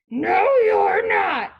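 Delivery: slow, high, loud troll shout; troll's roar. A narrator's reading. Troll refuses the second goat passage, roaring. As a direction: bellow the refusal slowly. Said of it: "roared the troll".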